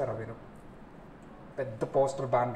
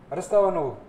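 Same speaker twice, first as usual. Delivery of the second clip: ordinary delivery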